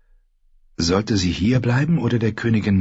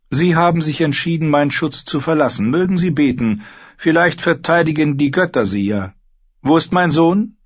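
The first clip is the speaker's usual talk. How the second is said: higher-pitched, dismissive queen; imperious queen; 10 semitones higher, 3 dB louder than usual. A narrator's reading. Queen dismisses the women and demands her son, imperious. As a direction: answer as a queen who expects obedience.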